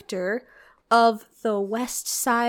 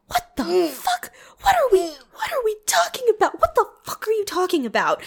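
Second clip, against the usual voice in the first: baffled outcry; 10 semitones higher than usual. Retold or imitated. Reacting to the movie's twist, disbelieving outcry. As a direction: cry out in total confusion. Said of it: "And I was like".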